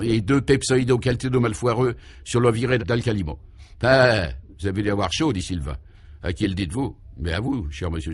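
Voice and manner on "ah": ordinary delivery, nothing marked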